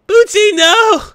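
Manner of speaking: hammed-up high loud wail; mock anguished cry. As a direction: cry out in fake despair.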